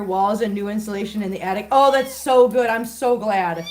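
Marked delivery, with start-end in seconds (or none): none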